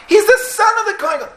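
very high, loud cry of amazement; astonished realization. Acted. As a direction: exclaim it at the top of your range, stunned. Astonished recognition in the story.

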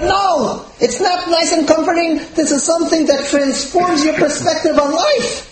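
high-pitched, impassioned correction; emphatic objection. Acted. Rabbi's emphatic rejection, acted.

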